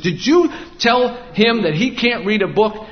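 indignant mother. Retold or imitated. Mother storming the library confronting the librarian, high indignant. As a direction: confront her like an outraged mom.

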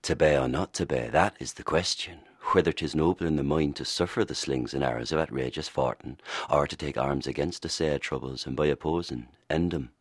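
Shakespeare in original pronunciation, rolled r's and broad vowels. Shown as a demonstration. Actor demonstrating Hamlet for the radio host in Elizabethan accent. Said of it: "Can you give us a for instance".

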